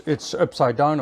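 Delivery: normal talking voice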